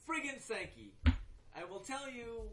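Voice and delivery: real exasperation; exasperated. Real, not acted. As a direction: blurt it out, sick of this player. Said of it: "That's my little Bishop Sankey rant".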